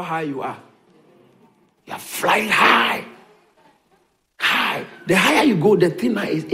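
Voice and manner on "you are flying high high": loud, slow exultant cry; exultant call. Real, not acted. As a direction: lift the room up. Exultant proclamation.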